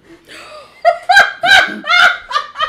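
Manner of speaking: high screaming cackle; loud shrieking laugh. Real, not acted. Friend hearing the caught-in-bed story, shrieking laugh. After the laundry story punchline.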